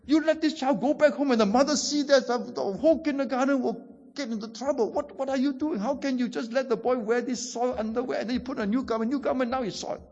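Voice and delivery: high-pitched, exasperated principal's telling-off; lightly acted scolding, indignant questions; mock scolding. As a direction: imitate a principal reproaching a teacher in disbelief. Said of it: "kena scolded lah. And then the principal".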